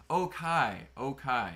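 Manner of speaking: Okai stated carefully as oh-KYE, twice; pronounced Okai. Shown as a demonstration. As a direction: demonstrate Okai, ending like "sky". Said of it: "That's how you pronounce it".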